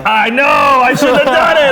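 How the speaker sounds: high, loud, slow whining protest; whiny shout. Retold or imitated. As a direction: wail the excuse back at her. Answering wife's scolding.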